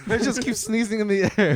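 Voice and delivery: ordinary delivery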